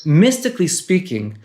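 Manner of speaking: ordinary talk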